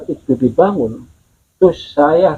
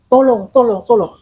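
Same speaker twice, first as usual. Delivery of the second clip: higher-pitched calls of "help", not shouted; retold cry for help; 6 semitones higher than usual. Villager retelling someone's call for help, pitched-up mock cry.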